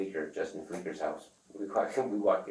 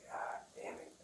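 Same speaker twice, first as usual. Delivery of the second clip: muttered curse; 10 dB quieter, slower than usual. Retold or imitated. Exasperated under breath.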